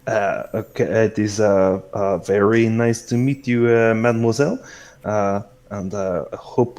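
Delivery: put-on accommodating host with a French accent; courteous French-flavored hostess. Celeste answering Yafai's request for bugs, gracious French-accented hostess. Game master plays Celeste.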